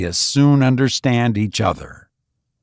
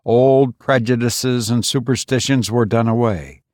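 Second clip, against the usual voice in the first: ordinary delivery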